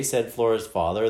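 ordinary delivery, nothing marked

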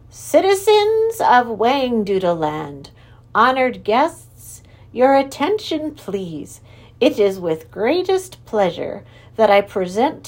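slow, ringing court announcer; grand proclaiming herald; herald's proclamation. Acted. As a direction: announce the royals to the hall.

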